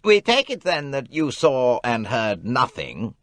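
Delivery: impatient. Acted. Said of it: "said Race impatiently".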